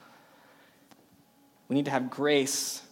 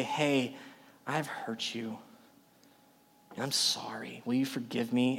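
sincere apology; 3 semitones lower, 6 dB quieter than usual. Acted. Acting out an apology to a friend.

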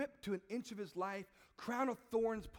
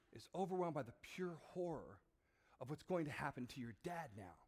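normal talking voice

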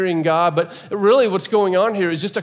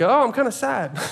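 higher-pitched, trivial little sorrow; mock mild sadness; 7 semitones higher than usual. Preacher showing what woe is not, mock mild sadness. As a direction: play down the sadness in a light, high voice. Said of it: "it's not just like".

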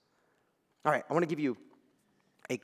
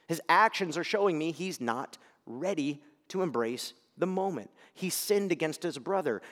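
ordinary talk

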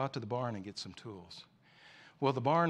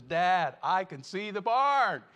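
louder, higher, slow thrilled cry; joyful discovery; 6 semitones higher, 8 dB louder, slower than usual. Acted. Little boy calling to his dad, thrilled. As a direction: shout out the good news. Boy finally sees the barn.

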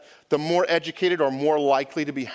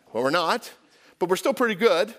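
ordinary talk, nothing marked

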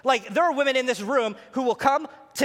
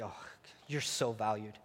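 tender; 11 semitones lower, 12 dB quieter than usual. Shown as a demonstration. Voicing a husband's praise.

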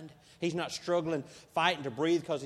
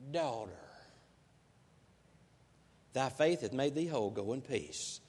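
tender; 5 semitones lower than usual. Acted. Jesus' gentle words to the woman.

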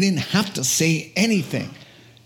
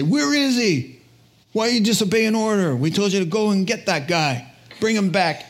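higher, quick, demanding questions; indignant boss, pitched up; acted authority scolding; 5 semitones higher than usual. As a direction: dress down the empty-handed guards. Authorities scold returning guards.

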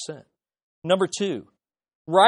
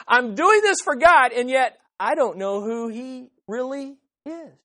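zealous believer; 4 semitones higher, 4 dB louder than usual. Acted. Voicing zealous Saul's mindset.

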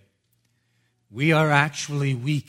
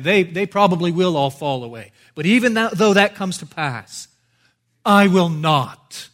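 higher and louder, insisting; Peter protesting loudly; 5 semitones higher, 10 dB louder than usual. Retold or imitated. Preacher voices Peter refusing Jesus's prophecy, loud and higher. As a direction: throw the objection back at Jesus.

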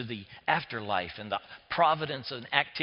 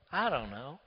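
ordinary talk

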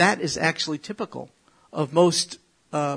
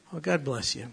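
usual speaking voice

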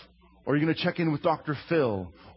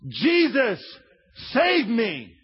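higher, louder plea for rescue; desperate cry; 6 semitones higher, 4 dB louder, much slower than usual. Acted. Peter sinking in the storm, crying out loudly to Jesus.

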